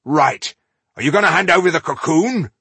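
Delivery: loud, high, fast demand; impatient demand. Acted. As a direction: push him to hand it over. Ironheart demanding.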